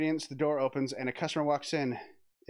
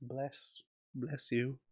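normal talking voice

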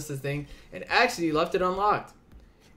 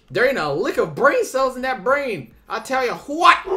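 put-on angry Southern accent; 8 semitones higher, 7 dB louder than usual. Character fed up with the dog, angry Southern accent. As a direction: rant like a fed-up country man. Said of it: "My accent sort of slips whenever I get angry".

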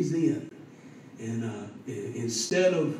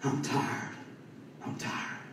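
near-whispered, drained imitation; retold exhaustion; 3 semitones lower, 8 dB quieter, slower than usual. Worn-out man on the phone to his preacher, exhausted. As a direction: let it fade out softly, worn out. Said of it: "all he could say each time".

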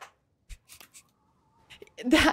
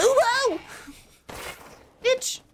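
shrieked much higher; yelping from a real fright; jump scare yelp; 11 semitones higher than usual. Real, not acted. Gamer shrieks at a scarecrow jump scare, high yelp.

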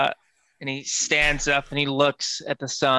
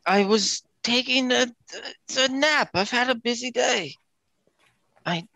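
higher-pitched wheedling excuses; lilting, higher voice of an apologetic schemer; groggy debtor, placating; 5 semitones higher than usual. Acted. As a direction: talk your way out of a debt. Player plays Oz pleading.

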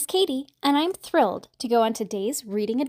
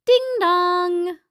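sing-song two-note doorbell; doorbell chime; 5 semitones higher, 4 dB louder than usual. A narrator's reading. Doorbell rings at the farmhouse, sing-song chime. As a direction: chime it high, holding the last note.

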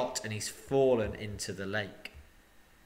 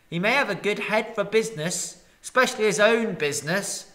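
grumbling townsfolk; 7 semitones higher, 6 dB louder than usual. Acted. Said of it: "some murmured".

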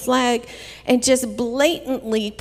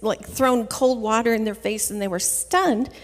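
ordinary talk

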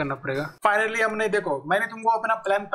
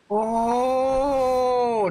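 long, high, drawn-out "oh"; amazed exclamation; 5 semitones higher, 3 dB louder than usual. Real, not acted. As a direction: marvel on one raised, held vowel. Amazed by the attack shown. (हैरानी से ऊँचा, लंबा "ओ")

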